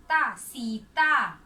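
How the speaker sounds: "ता, सीता" loud and slow, long vowels; forceful, stretched syllables. Shown as a demonstration. Teacher repeating the word for pupils to join in, loud and drawn out. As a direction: belt out "ता, सीता" with each syllable held. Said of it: "जोर से खींच कर बोलना है".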